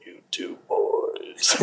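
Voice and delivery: slow, whispered and menacing; hushed whisper. Retold or imitated. Imitating the still, squinting sketch character in a slow whisper. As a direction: breathe the words slowly, unvoiced. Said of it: "standing very still and squinting".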